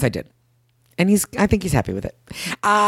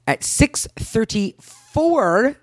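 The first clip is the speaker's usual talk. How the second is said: very slow, stretched words, rising at the end; drawn-out suspense; 5 semitones higher, much slower than usual. Real, not acted. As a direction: draw out each number for dramatic effect. Drawing out the time for suspense.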